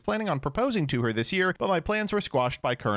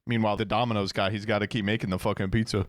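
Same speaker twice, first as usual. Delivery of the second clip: ordinary delivery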